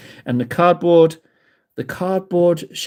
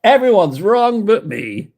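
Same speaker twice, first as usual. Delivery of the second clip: smug arrogant voice; 4 semitones higher, slower than usual. Pretend. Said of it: "yeah, everyone else is wrong but me".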